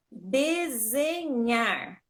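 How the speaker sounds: desenhar very slow, syllables stretched, ending on strong NHAR; tônica no NHAR. Shown as a demonstration. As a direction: draw out desenhar towards a stressed NHAR with R. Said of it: "É o nhar". ("desenhar" bem devagar, com o NHAR forte)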